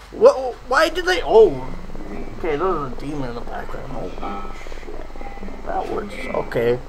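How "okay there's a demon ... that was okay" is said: spooked hush. Real, not acted. Reacting to something spooky.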